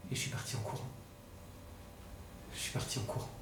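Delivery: very low, hushed, voiceless ending; stunned, numb. Acted. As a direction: let the voice sink away in shock. Stunned brother ends his account. (murmure éteint, sous le choc)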